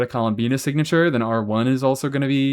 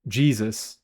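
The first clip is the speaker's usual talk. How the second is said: ordinary talk, nothing marked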